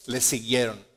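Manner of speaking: usual speaking voice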